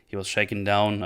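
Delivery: ordinary talk, nothing marked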